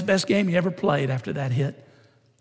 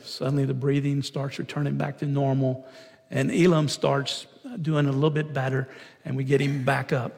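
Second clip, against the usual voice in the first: usual speaking voice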